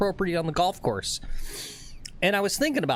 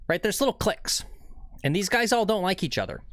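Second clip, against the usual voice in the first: ordinary delivery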